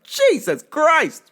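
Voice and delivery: stunned. Retold or imitated. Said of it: "And I was like".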